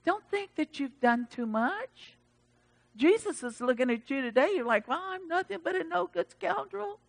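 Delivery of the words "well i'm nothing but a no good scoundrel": high, soft meek voice; put-on self-deprecation. Churchgoer putting himself down to Jesus, meekly mocked. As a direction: play a small, sheepish sinner.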